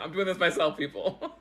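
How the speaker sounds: laughing out the words, loud; amused self-mocking laugh on the words. Real, not acted. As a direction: laugh through the line at herself. Laughing at her own camera fix.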